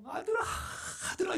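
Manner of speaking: very high, plaintive calling out; father calling for his lost son; mournful calling. Retold or imitated. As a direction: call the son desperately through tears. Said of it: "애절한 마음을 가지고 울면서".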